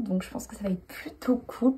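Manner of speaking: soft conspiratorial whisper; playful hushed aside. Pretend. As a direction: drop to a whisper for the viewers.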